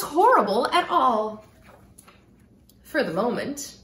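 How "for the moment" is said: ordinary delivery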